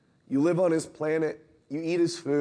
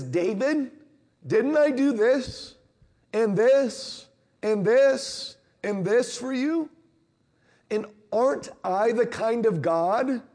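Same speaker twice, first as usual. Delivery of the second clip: pleading God voice; 6 semitones higher than usual. Retold or imitated. God reminding David of his gifts, slow earnest pleading. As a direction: lay out every gift with emphasis.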